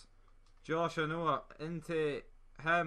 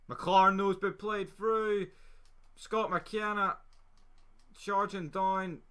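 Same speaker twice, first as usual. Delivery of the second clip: excited commentary; 5 semitones higher, 3 dB louder than usual. Real, not acted. Attacking run in match.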